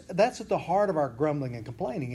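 normal talking voice

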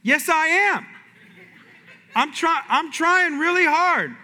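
high, slow stubborn insisting; mock insistent. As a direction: plead slowly, sure of it. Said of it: "And I was like".

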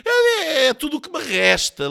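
high, loud whine; hammed-up self-pity; whining lament. A narrator's reading. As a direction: lament it like a wailing martyr. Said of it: "lamuriou-se ele".

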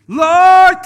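high, very loud wailing plea imitated; yelling out. Woman at the altar begging the Lord, imitated loud yelling. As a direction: mimic a woman hollering to heaven. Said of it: "yelling out loud".

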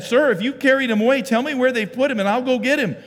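loud, high acted pleading; urgent plea. Mary begging the gardener for her son's body, urgent plea.

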